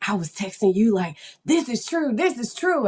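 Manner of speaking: hammed-up rush of a mother insisting it's true; excited.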